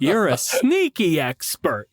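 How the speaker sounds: laughing. Retold or imitated. Retold delighted discovery of expert.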